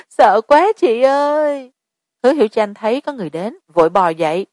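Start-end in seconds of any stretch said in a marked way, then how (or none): 0.1-1.7 high, slow, trembling with fear; a scared girl pleading with an older sister; frightened girl. A narrator's reading. Scared girl to older sister, frightened and slow.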